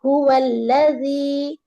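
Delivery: هو الذی with the lam kept short, one beat; don't stretch the lam; ایک حرکت لام. Shown as a demonstration. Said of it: "لام کو لمبا نہیں کرنا ہے".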